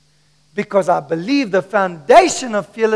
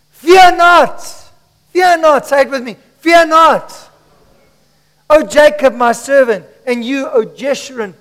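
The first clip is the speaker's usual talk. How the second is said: higher, emphatic scripture proclamation; raised prophetic preacher voice; preaching; 5 semitones higher, 7 dB louder than usual. Acted. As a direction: ring out each command to the congregation.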